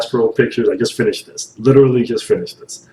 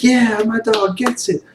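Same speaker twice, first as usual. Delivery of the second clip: high, slow, goofy "my dog gets it"; goofy approving voice; 13 semitones higher than usual. Retold or imitated. Watching the show, cheering the guy on in a high, goofy voice. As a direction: squeak out a slow, cheeky approval. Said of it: "and I was like".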